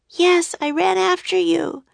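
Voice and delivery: put-on high girl's voice, slightly hoarse; Annie, hoarse. A narrator's reading. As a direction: answer with a touch of hoarseness. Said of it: "Her voice was hoarse, too".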